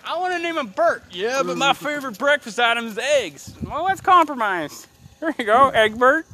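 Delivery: high-pitched mock parents' voices; imagined bickering parents. Parents haggling over the baby's name, high mock voices. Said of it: "how do you come up with that".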